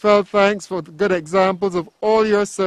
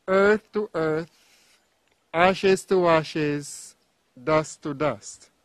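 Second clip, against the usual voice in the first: slow solemn committal; slower than usual. Real, not acted. Committal words at graveside.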